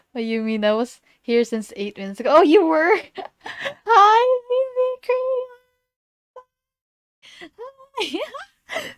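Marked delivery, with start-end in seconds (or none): 2.4-8.9 high, loud greeting through laughter; excited laughing hello. Real, not acted. Streamer greets Vivi in chat, high laughing excitement. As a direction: squeal and giggle a welcome.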